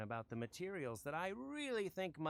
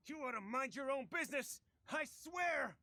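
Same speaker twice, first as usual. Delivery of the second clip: angry shout; 4 semitones higher than usual. Acted. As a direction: bark the threat. Thug threatening.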